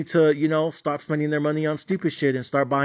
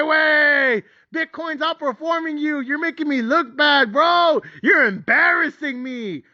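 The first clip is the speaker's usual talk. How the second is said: building from grumbling to shrieking high yells; hammed-up tantrum at his mutual fund; escalating rant at fund; 8 semitones higher than usual. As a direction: scream at the mutual fund like a lazy worker. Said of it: "I'm all like".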